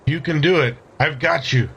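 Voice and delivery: ordinary talk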